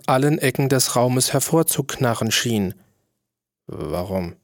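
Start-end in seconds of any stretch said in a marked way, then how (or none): 3.6-4.3 quiet menacing start. A narrator's reading. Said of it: "wandte sich der König wütend".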